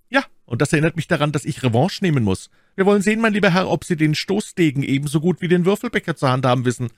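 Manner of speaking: high, fast, eager gallant; challenging young gallant. Acted. D'Artagnan demanding revenge from the Englishman, spirited.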